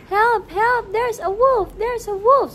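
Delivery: high, loud cry for help; shouting for help. Acted. The boy shouts to the villagers for help, loud and high. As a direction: yell it like the boy fears the wolf. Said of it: "He said".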